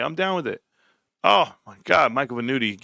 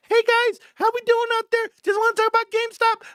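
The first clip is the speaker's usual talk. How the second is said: much higher, squeaky cute YouTuber voice; cat-like voice. Pretend. Mock YouTuber greeting viewers about GameStop, much higher cat-like squeak. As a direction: chirp it like a cartoon kitten. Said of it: "in a cat-like voice".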